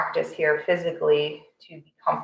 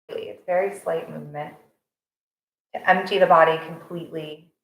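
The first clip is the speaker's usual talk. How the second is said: guided meditation. Real, not acted. Instructor settling a morning class into breath awareness, calm guided meditation. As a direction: guide them gently into the breath, unhurried.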